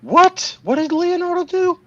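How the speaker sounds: high, loud shocked question; sudden disbelief, pitch jumps up; surprised. Real, not acted. Shocked by celebrity news.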